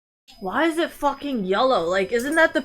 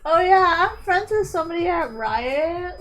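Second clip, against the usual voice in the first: mock bragging; 7 semitones higher than usual. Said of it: "It's not like".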